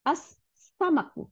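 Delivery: "আস-সামাকু" slowly with a long hissed S; slow model of "আস-সামাকু", S doubled. Shown as a demonstration. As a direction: hold the S in "আস-সামাকু", no L sound. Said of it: "সিনকে দুইটা সিন বানাই দিছে".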